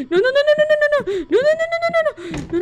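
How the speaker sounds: frantic denial; terrified no no. Real, not acted. Player panicking as the monster closes in, shrill no's.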